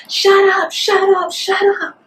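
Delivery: high-pitched mock holler from afar; friends calling out. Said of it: "from down the street".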